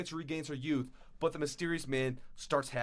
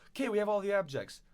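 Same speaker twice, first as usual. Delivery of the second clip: excited; 8 semitones higher, 4 dB louder, faster than usual. Retold or imitated. Characters announce success excitedly.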